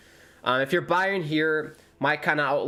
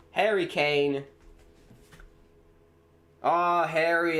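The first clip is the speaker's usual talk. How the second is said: ordinary talk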